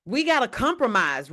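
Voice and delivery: usual speaking voice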